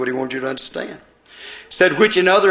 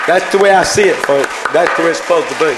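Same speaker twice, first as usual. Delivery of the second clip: fiery preaching; 3 semitones higher, 7 dB louder than usual. Acted. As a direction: pound it home to the congregation. Preacher at full force.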